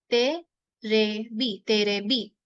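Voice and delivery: तेरेबी drawn out very slowly; slow demo. Shown as a demonstration. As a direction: stretch तेरेबी for learners. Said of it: "कहते हैं तेरेबी". (तेरेबी धीरे)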